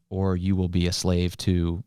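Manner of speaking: usual speaking voice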